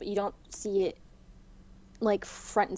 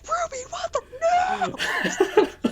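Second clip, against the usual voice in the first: re-enacted high screech, long drawn out; mock hysterical shriek; 4 dB louder, much slower than usual. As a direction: screech it way up high, stretching the words.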